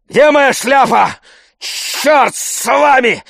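loud, high, drawn-out furious yells; shouting. A narrator's reading. Tarantyev leaving across the yard, shouting curses. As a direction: hurl threats and curses as you storm out. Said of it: "кричал он, идучи по двору".

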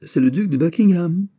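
high, loud, mocking burst of laughter; laughing outright. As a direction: toss the name out laughing. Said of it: "en riant aux éclats". (en riant aux éclats, plus aigu et plus fort)

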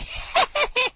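very high, squeaky wheezing laugh; breathless cartoon-dog wheeze; wheezy laugh. Real, not acted. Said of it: "I'm doing wheezy laughs here".

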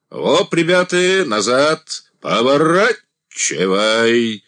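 loud drawn-out shout; calling out across the square. A narrator's reading. Calling out to the wagon train.

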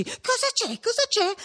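high, pressing repeated question; insistent alarmed question. A narrator's reading. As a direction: press her for an answer, pitched high. Repeats question, grabbing her arm.

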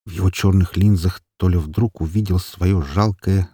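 ordinary delivery

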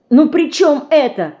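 curt. A narrator's reading. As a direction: cut her off abruptly. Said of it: "резко оборвала Динка".

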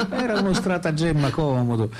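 said through a laugh; amused, still laughing. Real, not acted. The writer, still laughing, admits his childhood trick.

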